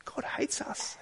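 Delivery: a soft, breathy echo of the complaint; hushed mock despair; whispered. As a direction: whisper it like hidden self-talk.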